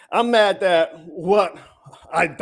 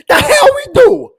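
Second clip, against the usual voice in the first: much higher and louder, slow, angry yell; furious shout; 13 semitones higher, 8 dB louder than usual. Real, not acted. Fan yelling back at the GM's claim, furious shout. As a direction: bellow it back at him in disbelief.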